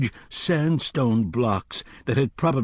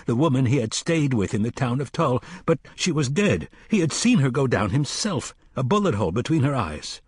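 usual speaking voice

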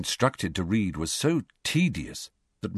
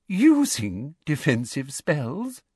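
slow, high mocking repeat; disbelieving, condescending echo; mock disbelief; 8 semitones higher, slower than usual. Umbridge mocking question.

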